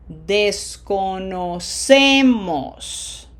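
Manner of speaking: Desconocemos very slowly, syllable by syllable, leaning on ce; drawn-out teacher demo of Desconocemos; long vowels, penultimate stress. Shown as a demonstration. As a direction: stretch out Desconocemos with a big stressed ce. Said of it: "it is a P E two".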